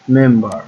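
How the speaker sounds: Member with a loud opening মেম; stress on first syllable. Shown as a demonstration. (মেম্বার-এর মেম অংশে জোরে চাপ)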